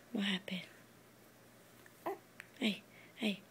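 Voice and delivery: hushed soothing whisper. Real, not acted. Mother soothing upset child.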